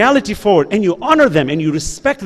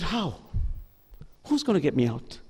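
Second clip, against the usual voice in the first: much softer and lower, a touch of despair; hopeless plea; 5 semitones lower, 14 dB quieter than usual. Retold or imitated. Lost boy asking the imam how to escape, quietly hopeless. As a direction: ask it like there is no way out.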